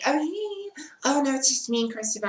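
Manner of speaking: suddenly high, sheepish "it's only me" voice; high-pitched innocent greeting. Retold or imitated. Caught at the door by the security guard, high and sheepish.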